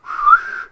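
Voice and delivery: high sharp whistle; a quick signal whistle. Retold or imitated. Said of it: "She did, like, a whistle".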